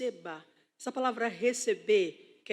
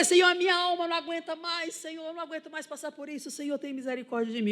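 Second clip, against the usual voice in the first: raised, pleading cry for mercy; high, urgent supplication; retold desperate prayer; 6 semitones higher, 4 dB louder, faster than usual. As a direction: re-enact begging God, words tumbling out fast. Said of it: "comecei a gritar".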